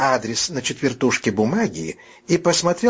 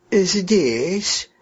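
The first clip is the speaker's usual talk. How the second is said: put-on old woman's sinking, dragged-out reply; drawn-out, crestfallen; 5 semitones higher than usual. A narrator's reading. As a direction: stretch it out, heart sinking. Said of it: "протянула бабушка упавшим голосом".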